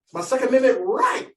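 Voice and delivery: slow, proud gun-owner boast. Retold or imitated. Rancher claiming his gun rights to the nephew, hammed-up drawl.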